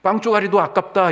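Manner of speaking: high, loud, scornful retort; imitating a scornful dismissal; contemptuous quote. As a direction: spit out the insult with contempt. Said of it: "이렇게 지금 얘기하신 거예요".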